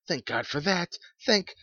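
high, loud thanks that breaks off; relief cut short. A narrator's reading. Old woman clasping her hands, relieved, then suddenly breaking off. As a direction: gush thanks, then stop dead. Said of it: "she broke off suddenly".

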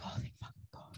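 whispered relief, acted out; relieved whisper. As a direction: whisper it like a prayer of thanks. Retells relief at the rescue.

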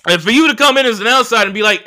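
normal talking voice

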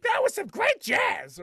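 high, hammed-up cheer of a jazz fan; celebrating shout. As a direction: whoop it up in a put-on high voice. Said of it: "celebrating. They're like".

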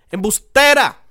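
mock-indignant high, loud outburst; retold accusing shout. Teller re-enacts rejecting the girl's trap, accusing shout.

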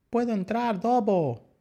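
put-on very high, pleading baby voice; little lamb. A narrator's reading. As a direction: beg like a tiny lamb locked out. Lamb begs at the door. (súplica aguda de ovejita)

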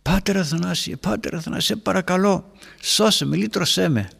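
high, pleading cry for help; imitating a distraught man's high plea; agitated plea. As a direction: beg the priest in a panic. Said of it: "με πολύ ταραχή".

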